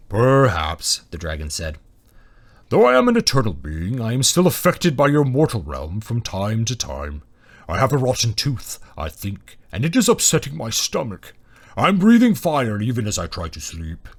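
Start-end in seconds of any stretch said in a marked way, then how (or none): 0.1-1.0 loud, rumbling dragon; big beast's voice; deep booming dragon. A narrator's reading. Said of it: "booming and deep". 2.7-14.1 booming dragon voice, complaining; rumbling, aggrieved dragon; grand suffering dragon. A narrator's reading.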